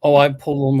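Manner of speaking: ordinary talk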